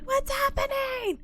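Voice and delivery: high, slow and squeaky. Retold or imitated. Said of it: "So I'm like".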